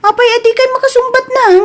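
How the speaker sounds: high, fast, pressing question; urgent demand. Acted. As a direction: push hard for an answer. Daughter demands an answer.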